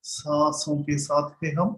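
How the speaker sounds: slow, measured meditation guide; guided meditation. Real, not acted. Teacher guiding students to sit at ease with the breath, slow meditation voice. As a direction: lead them into stillness, drawing out words between long silences.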